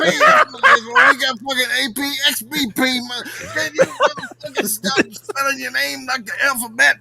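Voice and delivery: laughing rant. Real, not acted. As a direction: crack up while complaining. Laughing at spelled call letters.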